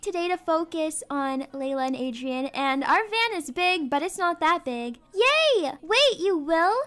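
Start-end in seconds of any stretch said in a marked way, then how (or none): 5.1-5.7 excited cheer. Acted. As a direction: cheer like a delighted kid. Kids hear they'll visit.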